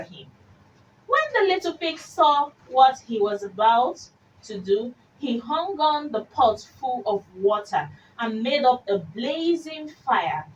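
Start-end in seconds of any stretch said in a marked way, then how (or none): none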